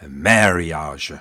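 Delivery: marriage said slowly with a heavy rolled American R, a-MARR-ee-ahrge; strong R sound. Shown as a demonstration. Reading a listener's note on Shakespeare, strong American R in marriage. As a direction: lean hard into the R of marriage, low and drawn out. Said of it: "with a very American emphasis on the R sound".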